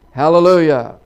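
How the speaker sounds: loud, triumphant shout of praise; hollered praise. Acted. Old farmer reading of the bottomless ocean, hollering. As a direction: belt out the word in exultation. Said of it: "he hollers out".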